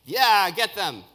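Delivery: acted shout from an eager mob; crowd shout. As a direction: cheer on the attack like a rallying crowd. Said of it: "all the people would shout".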